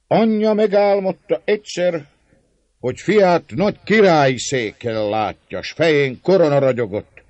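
put-on loud, high drunken storytelling; tipsy old Székely boasting to the feast; drunken old man. A narrator's reading. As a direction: tell the prophecy, swaying drunk. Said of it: "szólt az előbbi az asztalba fogódzva".

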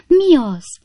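high, loud gasped question; startled cry. A narrator's reading. Lady hears a man behind curtain, startled cry. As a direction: gasp it out high in alarm. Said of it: "kiáltott fel a javakora beli hölgy".

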